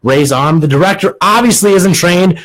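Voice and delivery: ordinary talk, nothing marked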